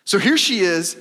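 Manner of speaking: ordinary talk, nothing marked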